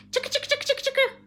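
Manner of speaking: high, loud squirrel chatter; squirrels' scolding shout. Acted. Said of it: "shouted at her".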